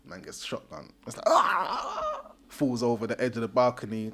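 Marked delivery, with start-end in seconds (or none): 1.3-2.3 high, loud hammed-up yelp; mock death cry. Tony shot off the balcony, high yelp.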